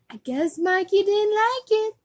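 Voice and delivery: put-on high, loud kid voice climbing upward; acted commercial punchline. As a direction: land it like a boy in an ad. Acting out ad's punchline.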